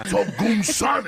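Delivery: high, slow rallying cry; fiery hype. Retold or imitated. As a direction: fire them up with it. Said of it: "fire 'em up".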